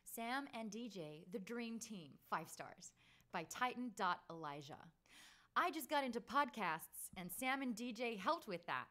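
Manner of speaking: Australian doing a big American read; broad American accent, slow and showy; hammed-up American review read. Shown as a demonstration. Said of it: "I'm gonna do it in my American accent".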